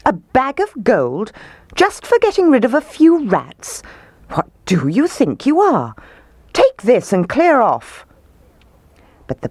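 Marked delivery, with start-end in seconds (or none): 0.1-8.1 high, outraged dismissal; indignant roar. A narrator's reading. Mayor refusing to pay the Pied Piper, angry roar. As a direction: build outrage, then send him packing. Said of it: "roared the mayor".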